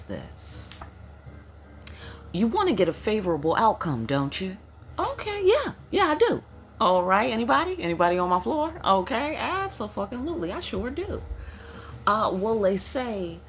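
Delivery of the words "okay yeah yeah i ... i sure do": ancestor voice. Acted. Channeling the ancestors to the host, in a high sassy voice. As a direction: voice of the ancestors, much higher and louder.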